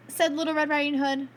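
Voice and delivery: ordinary delivery, nothing marked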